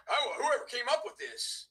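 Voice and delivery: lightly mimicking a rival's gripe; a hint of a snide mimic; mocking whiny quote. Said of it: "Useless is in there".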